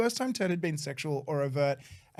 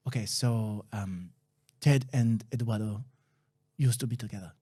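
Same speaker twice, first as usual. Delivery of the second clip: lightly voiced, hesitant explainer; the boyfriend nervously explaining the history; lowered confiding voice; 5 semitones lower than usual.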